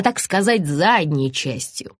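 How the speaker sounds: ordinary delivery, nothing marked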